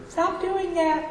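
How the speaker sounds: high, slow, soft mock-mother scold; nagging mom. Churchgoer imitating his mother's scolding, high and slow. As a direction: do a nagging mom telling you off. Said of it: "I can hear her".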